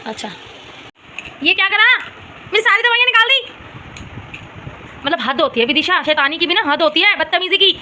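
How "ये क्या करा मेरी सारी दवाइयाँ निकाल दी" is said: sharp, much higher exasperated scold; angry scolding. Real, not acted. Mother scolding daughter for emptying her medicines, high sharp scold. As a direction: tell her off, shocked and cross. Child pulled out all medicines.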